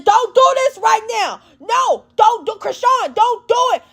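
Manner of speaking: high-pitched pleading yell, mimicking; imitated yelling. Bystander yells at Chrisean not to start, mock yelling. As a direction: yell it high, like begging someone to stop.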